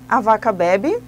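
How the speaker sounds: "A vaca bebe" thrown out briskly with a rise on "bebe"; quick-fire prompt. Shown as a demonstration. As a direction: snap the question at the class. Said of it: "vai dizer assim".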